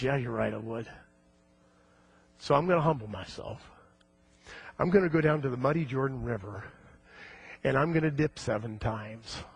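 a proud man quietly giving in; stricken, humbled. Acted. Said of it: "And he's stricken".

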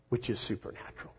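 whispered aside, fast; hushed aside. Real, not acted. Preacher adding a whispered aside to the congregation, hushed. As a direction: drop to a whisper as if sharing a secret.

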